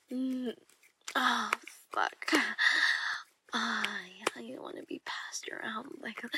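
sultry erotic whisper; seductive whisper. Acted. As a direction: whisper it to a lover in the dark.

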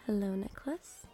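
ordinary talk, nothing marked

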